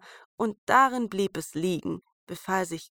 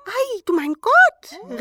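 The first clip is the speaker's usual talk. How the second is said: sehr hoch, laut und gedehnt; laut hoch vor Verwunderung rufen; staunender Ausruf; 9 dB louder, slower than usual. A narrator's reading. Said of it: "riefen sie". (hohes, lautes Staunen)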